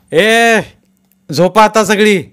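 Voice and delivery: loud, high command to sleep; ordering. A narrator's reading. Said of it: "order सोडली".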